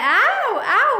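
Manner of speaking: high, loud mock baby cry of aao; imitating baby's wail. Mother imitates her baby calling her over, mock wail. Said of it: "चिल्लाने लग रहा है".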